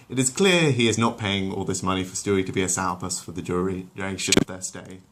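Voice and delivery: plain reading voice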